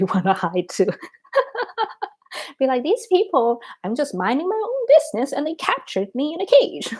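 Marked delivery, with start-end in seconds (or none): 1.3-2.1 high-pitched giggle; high, tickled laughter; amused laugh. Real, not acted. Laughs at own Yeti idea. 2.8-6.9 high-pitched mock complaint; a hint of comic outrage, pitched high; lightly voiced indignant Yeti. Voices an imagined captured Yeti.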